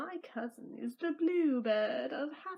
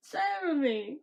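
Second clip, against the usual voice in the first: ordinary delivery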